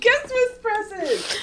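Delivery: very high, slow squeak; squeaky voice. Retold or imitated. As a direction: squeak it out slowly. Squeaky repeat of the phrase.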